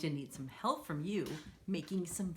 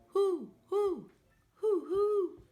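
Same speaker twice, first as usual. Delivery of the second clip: high, loud owl hoots; 13 semitones higher, 7 dB louder, slower than usual. Acted. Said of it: "He can sound like an owl".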